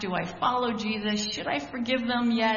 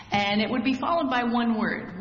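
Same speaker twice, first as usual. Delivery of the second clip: usual speaking voice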